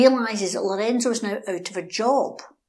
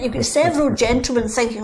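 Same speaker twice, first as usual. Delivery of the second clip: ordinary talk, nothing marked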